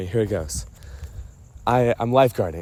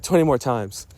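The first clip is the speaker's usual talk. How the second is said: ordinary talk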